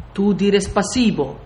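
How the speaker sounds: slow, halting foreigner's command; lightly voiced soldier teaching a foreign word; Russian soldier. Bearded Russian instructs the frightened narrator, slow and firm.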